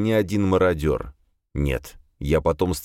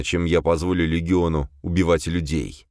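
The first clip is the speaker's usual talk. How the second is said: ordinary delivery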